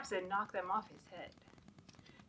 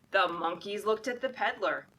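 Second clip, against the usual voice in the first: ordinary delivery, nothing marked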